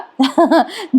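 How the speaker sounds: short chuckle; light laugh. Real, not acted. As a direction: let a brief laugh slip out. Narrator laughs at the scene.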